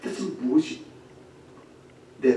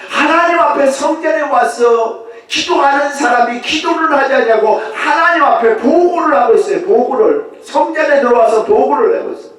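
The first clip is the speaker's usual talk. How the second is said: loud, high, insistent preaching; full-force preaching; 11 semitones higher, 11 dB louder than usual. Acted. Preacher to congregation about Pharisees, fervent. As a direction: hammer each phrase.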